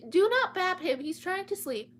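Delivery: scolding the cat. Real, not acted. Scolding cat mid-stream.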